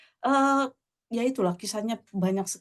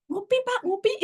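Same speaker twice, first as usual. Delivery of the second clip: imitated child's offer; 12 semitones higher than usual. Child offers vendor a coffee.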